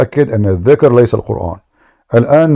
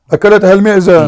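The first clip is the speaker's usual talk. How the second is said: أكلتها المعزة said higher, as a sarcastic jab; mocking punchline; 6 semitones higher, faster than usual. Real, not acted. Debater mocks opponent that the goat ate the verse, raised and sarcastic.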